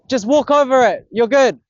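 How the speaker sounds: loud, high-pitched reassurance; calling out. Real, not acted. Angler waving bystander over his line, calling out. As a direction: shout directions to a passer-by.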